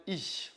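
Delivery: "ich" ending in a soft palatal hiss; German soft ch. Shown as a demonstration. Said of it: "C H". (« ich » avec le ch doux allemand)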